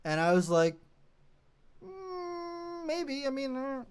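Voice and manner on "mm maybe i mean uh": high, soft hesitant whine; drawn-out high hum of doubt; reluctant hedging. Retold or imitated. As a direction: squeak out a noncommittal maybe. Acting out reluctant reply to request.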